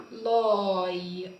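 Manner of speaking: لاي stretched very slowly; drawn-out sound. Shown as a demonstration. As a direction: hold لاي long. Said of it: "قلت إيه؟".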